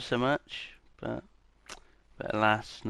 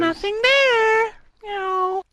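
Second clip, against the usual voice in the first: very high, slow quoted voice; high funny voice; 5 dB louder, much slower than usual. Retold or imitated. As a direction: squeak it out slowly. Quoting a fan video voice.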